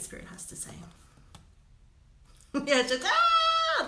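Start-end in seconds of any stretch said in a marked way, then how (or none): 3.0-3.9 very high, loud held "Ah!"; mock scream. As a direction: let out a comic high-pitched scream. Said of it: "That's what it feels like to me".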